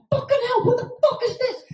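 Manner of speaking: high shrieking curse breaking into a laugh; high panicked shriek; shocked shriek. Retold or imitated. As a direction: shriek in mock panic at a burglar. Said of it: "and I".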